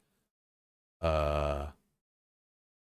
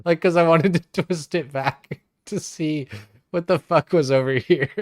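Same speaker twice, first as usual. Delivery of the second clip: higher and louder, laughing through it; 9 semitones higher, 6 dB louder than usual. Real, not acted. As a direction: break into laughter mid-sentence. Laughing at his own confusion.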